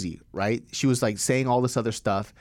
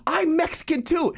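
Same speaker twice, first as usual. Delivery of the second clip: indignant high retort; 14 semitones higher than usual. Retold or imitated. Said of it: "she goes".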